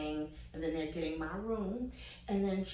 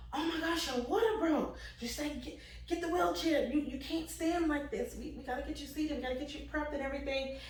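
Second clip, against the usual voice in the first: higher, stammering, rushed nurse voice; flustered nurse; 7 semitones higher, 3 dB quieter than usual. Retold or imitated. Nurse rushing patient to wheelchair: higher flustered stammer. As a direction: play the fussing nurse, a bit higher. Nurse panics over broken water.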